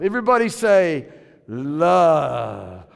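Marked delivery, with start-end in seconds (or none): 1.5-2.9 low, drawn-out romantic Love. Shown as a demonstration. Pastor croons Love to congregation, romantic. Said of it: "with the most romantic voice".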